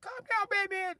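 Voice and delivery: very high, soft falsetto plea; squeaky nurse voice. Retold or imitated. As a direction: plead in a tiny squeak.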